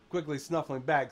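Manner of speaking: ordinary talk, nothing marked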